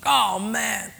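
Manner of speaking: whiny high complaint; grumbling. Acted. Believer grumbling at God, whiny complaint.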